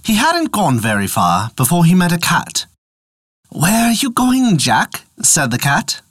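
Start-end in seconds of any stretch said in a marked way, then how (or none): 3.5-5.1 high, light cat voice. Acted. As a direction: voice a curious cat. Cat asks Jack.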